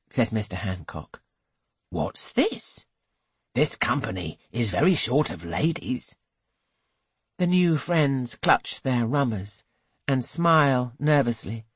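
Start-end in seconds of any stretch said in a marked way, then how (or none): 1.9-6.2 he frowns at the all-male company; gruff host. A narrator's reading. Hancock questions guests.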